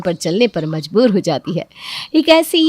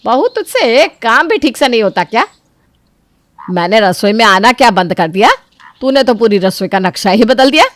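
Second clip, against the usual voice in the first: loud, harsh mother-in-law; scolding mother-in-law; 2 semitones higher, 5 dB louder than usual. Acted. Mother-in-law scolding Chanda over the spices, angry. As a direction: tear into her about the kitchen.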